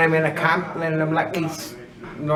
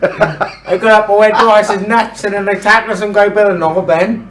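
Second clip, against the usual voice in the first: loud, laughing storytelling; excited; 4 semitones higher, 9 dB louder than usual. Real, not acted. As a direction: tell it loud and giddy. Excited retelling of rugby tackle.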